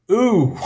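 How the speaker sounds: "Ooh," said as the long oo of glue; long vowel as in glue. Shown as a demonstration. Teacher models the long vowel, sounding it out. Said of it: "as in glue".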